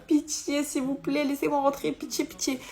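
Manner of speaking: begging. Retold or imitated. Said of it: "genre mendier".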